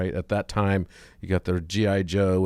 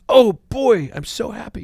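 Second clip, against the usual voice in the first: put-on far higher, excited child voice, loud start; delighted little boy; 8 dB louder than usual. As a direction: burst out thrilled like a five-year-old. Optimist boy voiced in joke.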